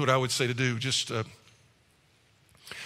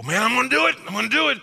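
bold resolve; 8 semitones higher, 4 dB louder, faster than usual. Retold or imitated. Self-reliant man's boast.

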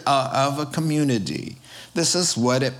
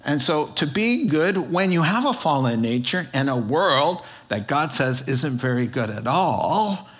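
normal talking voice

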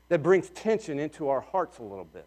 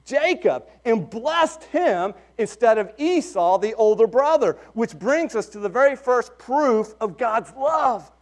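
excited preaching; 6 semitones higher than usual. Real, not acted. Preacher builds to high point.

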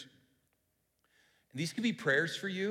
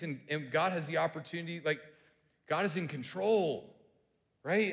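ordinary talk, nothing marked

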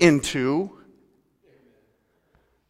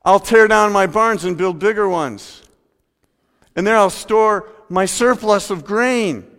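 boastful rich man; 5 semitones higher, 5 dB louder than usual. Acted. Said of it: "he said".

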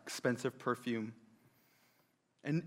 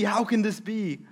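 higher, falling dismay; disbelief; 6 semitones higher, 4 dB louder than usual. Acted. A preacher voicing guests, falling dismay. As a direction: shake your head at it.

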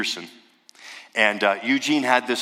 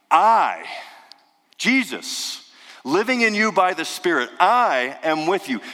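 "I" punched high and loud, then slow "I am with you"; emphatic I; 6 semitones higher, 3 dB louder than usual. Shown as a demonstration. Preacher voicing Jesus' promise with the stressed I. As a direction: lean hard on I, rising. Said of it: "it's emphasizing".

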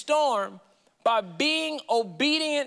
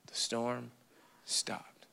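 hushed; 12 semitones lower, 12 dB quieter, much slower than usual. Real, not acted. Dramatic hushed close.

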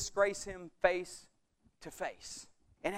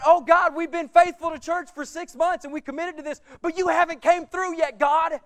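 loud, higher demanding complaint; impatient believer; 6 semitones higher, 8 dB louder than usual. Retold or imitated. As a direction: demand an answer from God. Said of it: "a lot of believers have".

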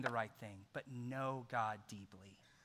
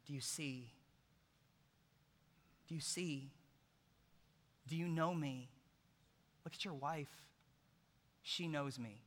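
the Lord's voice; 5 dB quieter than usual. Retold or imitated. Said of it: "like the Lord saying".

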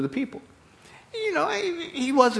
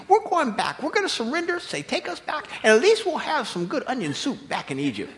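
high, whiny mock complaint; hammed-up grumbling mob; complaining Israelites; 13 semitones higher than usual. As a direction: sneer at Moses like a fed-up crowd. Israelites grumbling against Moses.

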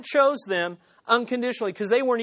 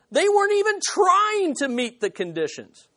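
emphatic shout; 10 semitones higher than usual. Real, not acted. Preacher driving point home.

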